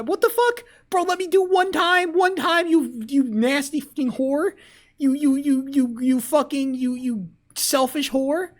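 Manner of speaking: real rage, spitting each insult; furious ranting. Real, not acted. Continued rage at Cryo.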